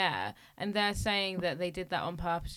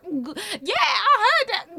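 mock outraged yell; 12 semitones higher, 11 dB louder, slower than usual. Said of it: "She's gonna be like".

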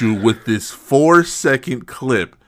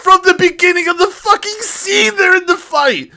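incredulous; 3 dB louder than usual. Real, not acted. Heated argument, incredulous outburst.

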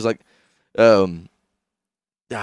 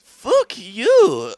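high put-on movie voice; hammed-up high voice; mock quote; 9 semitones higher, 4 dB louder than usual. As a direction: squeak it out like a film character.

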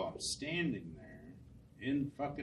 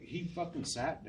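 ordinary delivery

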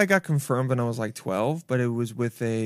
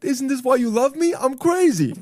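put-on cocky drunk boast; 11 semitones higher than usual. Quoting his own drunk boast.

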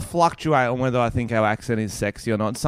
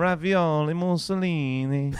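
high, stretched mock Italian; Italian accent; 6 semitones higher than usual. Demonstrating the classical sexy one, drawn-out Italian. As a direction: ham up a sultry Italian accent.

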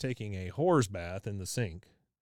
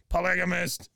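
high loud yell of polygamist; mock yell; 6 semitones higher, 4 dB louder than usual. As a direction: shout it across the yard, mockingly. Dale yells across yards.

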